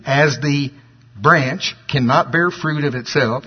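slow verse reading with weight on "branch"; stress on branch. Shown as a demonstration. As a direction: stop briefly before "branch", then stress it. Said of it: "gonna emphasize the word branch".

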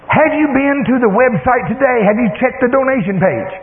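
high-pitched eager questioning; excited daughter. Retold or imitated. Daughter quizzes dad about the website, excited. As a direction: imitate an excited daughter pressing for an answer. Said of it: "She's all excited".

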